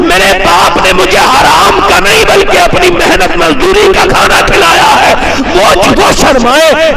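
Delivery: fiery shouted sermon, pitch climbing; impassioned shouting preacher; preaching at full force. Acted. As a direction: thunder it at the crowd with pride. Preacher raising voice to crowd.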